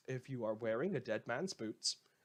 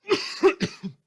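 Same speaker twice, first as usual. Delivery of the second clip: harsh hacking coughs, loud; loud coughing fit; 15 dB louder than usual. Acted. Radio host to his listeners, a loud hacking cough. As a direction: cough hard, choking mid-broadcast. Penalties of live broadcasting.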